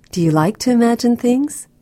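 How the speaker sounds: ordinary delivery, nothing marked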